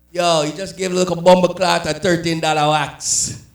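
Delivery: mock-scolding Jamaican mother; Jamaican mom. Mom sees the mouse trap, exclaiming in Jamaican patois. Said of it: "My mom".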